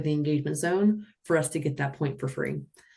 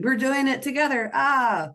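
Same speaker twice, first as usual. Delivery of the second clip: excited skaters; 9 semitones higher, 3 dB louder than usual. Pretend. Skaters voiced going out together.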